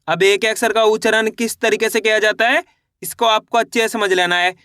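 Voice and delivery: ordinary talk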